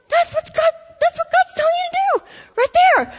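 cartoon gazelle realizing God's lesson; high gazelle voice. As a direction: play the gazelle in a tiny high voice. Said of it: "it's like".

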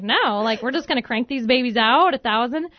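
eager retort. Retold or imitated. Eagerly answering doubtful husband.

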